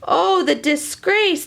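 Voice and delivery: hammed-up shock; mock dismay. Reader gasps at the golden finger, mock dismay. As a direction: gasp then slow, high Oh, the disgrace.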